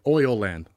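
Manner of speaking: Ireland said slowly as "oil land"; Irish pronunciation. Shown as a demonstration. Coaching cohost, oil land slowly for Irish Ireland. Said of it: "they say Ireland".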